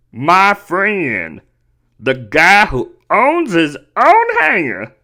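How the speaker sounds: slow, stretched-out mocking imitation; exaggerated, drawling mockery of a quote; mock elitist voice. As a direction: drag it out in a hammed-up snooty parody.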